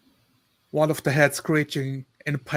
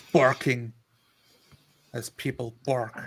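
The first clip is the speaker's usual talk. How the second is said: ordinary talk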